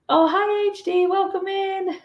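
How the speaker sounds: high, bright calling out of names; squealing hello to chat; excited greeting. Real, not acted. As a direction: sing out a welcome to the newcomers. Greeting a viewer arriving.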